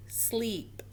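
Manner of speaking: ordinary delivery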